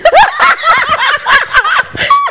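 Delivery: high, loud laughing shriek. Real, not acted. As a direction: crack up at your own joke.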